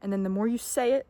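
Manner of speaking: ordinary delivery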